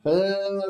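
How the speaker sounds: "Eh" held long on one steady note; continuous sound. Shown as a demonstration. Teacher showing continuous consonants to students, sustained sound. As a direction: sustain "Eh" without a break. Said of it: "son continuos".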